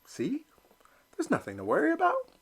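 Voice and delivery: very high, fast, nervous cheer; self-reassurance. Acted. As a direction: talk yourself out of fear. Elmer reassures himself.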